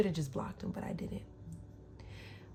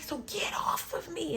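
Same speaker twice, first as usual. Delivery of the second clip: high-pitched retold plea; angry, tearful protest; 10 semitones higher than usual. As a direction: re-enact angry protest without real crying. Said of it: "I'm crying and I'm angry".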